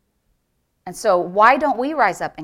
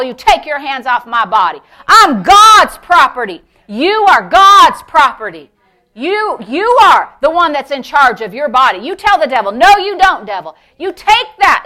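loud, raised, emphatic sermon delivery; full-force preaching; 4 semitones higher, 9 dB louder than usual. Real, not acted. Preacher urges believers to refuse sickness, fervently loud. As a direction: drive each phrase home to the congregation.

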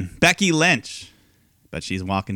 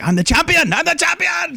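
much higher, louder ecstatic cry; mock joyful shout; 5 dB louder than usual. Host imitating Asuka celebrating her title, ecstatic. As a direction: squeal it in triumph. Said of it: "and she's like".

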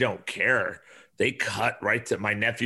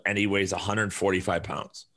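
ordinary talk

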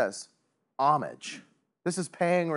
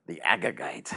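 sinister name; 8 semitones lower than usual. Shown as a demonstration. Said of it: "Doesn't sound like Peter Parker".

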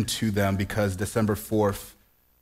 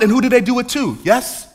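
higher and louder, rhythmic and near-sung; fervent preaching; 9 semitones higher, 8 dB louder than usual. Acted. Pastor warns the congregation in a rising full-force sermon. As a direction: drive each phrase home from the pulpit.

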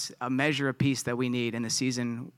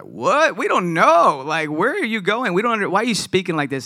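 higher, louder baffled outburst; confused Thomas; 4 semitones higher, 6 dB louder than usual. Acted. Thomas can't follow where Jesus is going, baffled and loud. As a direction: blurt it out like a lost disciple.

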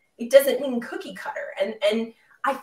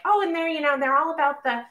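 lightly voiced critic, pitched up; imagined critic's voice; 4 semitones higher than usual. Host imitating critics of their talk on femininity, lightly mocking. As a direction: put on a slightly higher objector's voice. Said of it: "gonna be like".

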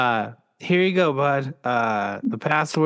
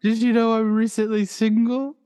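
high put-on voice; 10 semitones higher than usual. Dad in Wonder Woman voice.

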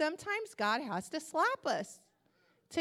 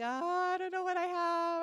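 mock wail; 5 semitones higher than usual. Sick mother answers child through locked door, mock wailing. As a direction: plaintively wail it, half playing.

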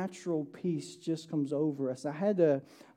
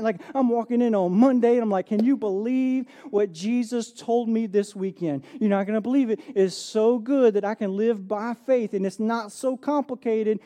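excited; 4 semitones higher, 7 dB louder than usual. Pretend. Acting out telling coworker excitedly.

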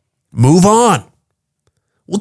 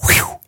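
mock whistle; 5 dB quieter than usual. Reacting to a woman walking in.

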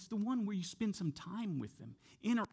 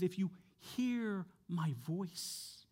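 "hear" and "voice" stretched very slowly; stress on hear my voice; 4 dB quieter, much slower than usual. Shown as a demonstration. Preacher correcting the misquote, slow weight on "hear my voice". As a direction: draw out "hear", pause, then a long soft "voice". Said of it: "No, he says".

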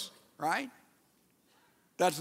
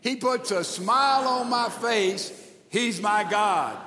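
full-voice recitation; 2 semitones higher than usual. Shown as a demonstration. Preacher leading church in reading together, full-voiced. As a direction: proclaim it out to the whole room.

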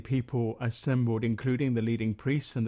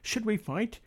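higher-pitched exclaimed question; exclaiming; 8 semitones higher than usual. A narrator's reading. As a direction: raise the voice in pitch for an urgent question. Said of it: "they exclaimed".

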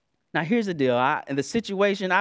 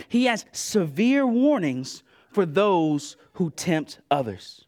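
usual speaking voice

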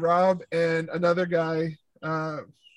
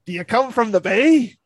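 put-on higher, louder Newfoundland accent; mock Newfie accent, pitched up; imitated Newfie kid; 5 semitones higher, 6 dB louder than usual. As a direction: ask like a curious Newfie kid. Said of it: "he sounds like he's, like, a newfie".

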